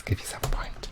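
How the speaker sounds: hushed whisper to the viewer. Acted. Quiz host whispering to the viewer. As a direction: drop to a whisper.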